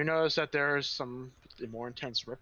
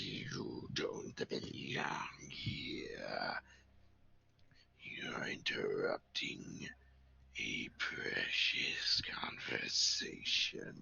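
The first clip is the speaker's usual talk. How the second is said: low, slow, unvoiced whisper; a hidden presence whispering a warning slowly; eerie whisper; 4 dB quieter, slower than usual. Mysterious voice speaks to intruder.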